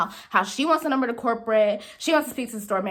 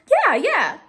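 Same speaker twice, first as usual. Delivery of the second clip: higher, louder, eager yes; chirpy phone agreement; upbeat agreement; 6 semitones higher, 5 dB louder than usual. Retold or imitated. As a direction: imitate a co-worker cheerily agreeing. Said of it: "the mood switched".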